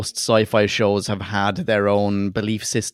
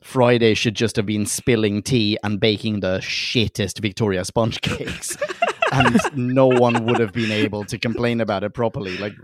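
ordinary talk

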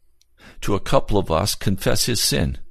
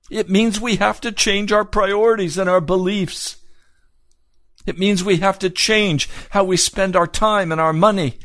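high, insistent preacher's exhortation; fervent preaching; 11 semitones higher than usual. Acted. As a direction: press each demand home in a raised, ringing cadence.

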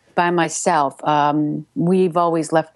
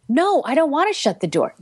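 higher-pitched refusal in dialogue; acted defiant reply; 9 semitones higher than usual. Shown as a demonstration. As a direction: play the partner refusing, pitched well up. Demo of acted dialogue exchange.

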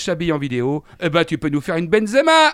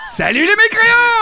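high, loud laughing farewell; laughing shout; 9 dB louder than usual. Real, not acted. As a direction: bellow the sign-off. Sign-off shout.

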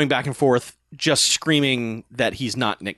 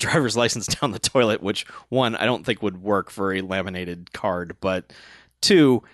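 ordinary talk

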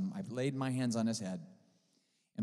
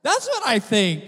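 much higher and louder, thrilled and incredulous; excited outburst; 12 dB louder than usual. Retold or imitated. Pastor telling the healed man he's healed, ecstatic. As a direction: burst out with delight at the good news.

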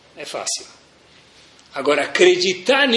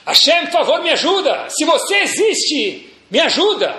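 high, loud cry for help; acted shout for rescue, pitched well up; shouted plea; 10 semitones higher, 3 dB louder than usual. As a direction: call out desperately to God. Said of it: "Ele grita".

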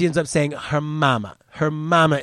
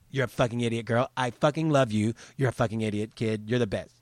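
ordinary talk

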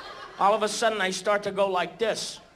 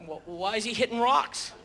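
normal talking voice

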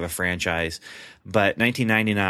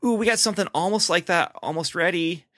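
eager studio exec; 8 semitones higher than usual. Retold or imitated. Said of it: "some other studios earlier saying".

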